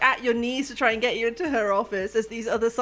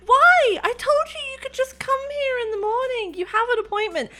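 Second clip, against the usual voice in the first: exasperated Tesh; 13 semitones higher than usual. Acted. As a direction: burst out high, then plead exasperated. Tesh scolds Schlep after panicking.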